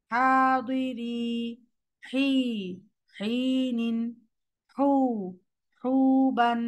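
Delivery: slow syllable-by-syllable recitation; recitation drill. Shown as a demonstration. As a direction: drill each letter with equal timing. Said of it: "equal timing দিয়ে যাইতে হবে".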